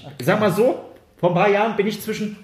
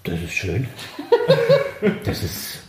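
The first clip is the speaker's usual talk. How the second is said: low, drowsy half-asleep dad; father woken from his nap answering the phone, low and drowsy; sleepy father; 6 semitones lower, slower than usual. Retold or imitated. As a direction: mumble it like a dad just woken up.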